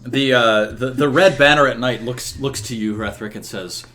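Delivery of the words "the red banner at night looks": normal talking voice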